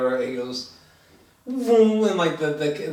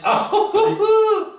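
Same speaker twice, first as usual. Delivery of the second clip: laughing exclaim; 6 dB louder than usual. Real, not acted. Laughing at the old-time music.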